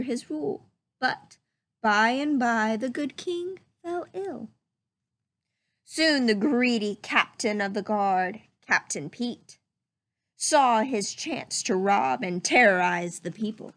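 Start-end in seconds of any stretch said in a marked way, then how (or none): none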